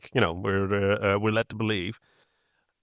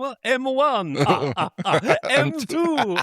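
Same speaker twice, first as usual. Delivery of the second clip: laughing; 8 semitones higher than usual. Acted. Joking computer-name voice with laughs.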